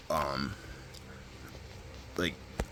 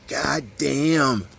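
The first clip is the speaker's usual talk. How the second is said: raised, high-pitched mock cry; half-yelled retold exclamation; 7 semitones higher, 4 dB louder than usual. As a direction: mimic his parting outburst, pitched up. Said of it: "the last thing he said was".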